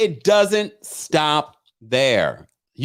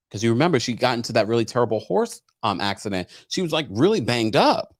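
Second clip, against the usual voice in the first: ordinary talk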